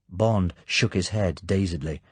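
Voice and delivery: ordinary delivery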